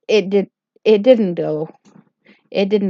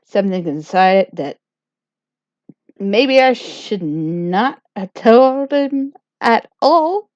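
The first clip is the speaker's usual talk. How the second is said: ordinary talk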